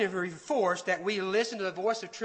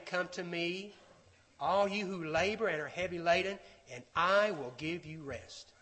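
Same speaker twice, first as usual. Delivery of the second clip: soft, lowered, gentle invitation; voice of truth; 4 semitones lower, 7 dB quieter than usual. Acted. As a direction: drop the volume and invite them in tenderly. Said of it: "the voice of truth is saying".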